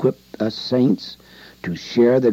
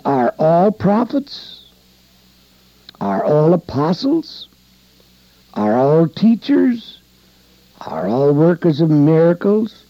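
higher, rising questions read from the pulpit; rhetorical questions; 6 semitones higher, 3 dB louder than usual. Acted. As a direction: lift each question to the congregation. Preacher reads Paul's questions.

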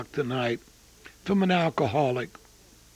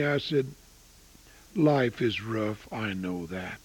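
tender comfort; 8 semitones lower, 6 dB quieter than usual. Retold or imitated. Tender letter quoted to grieving niece.

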